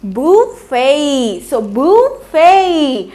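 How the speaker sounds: बुफे drawn out very slowly as boo-fay; slow model pronunciation. Shown as a demonstration. Teacher slowly modelling बुफे for students. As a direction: stretch बुफे slowly, no t at the end. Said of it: "ट कहीं भी नहीं आया है".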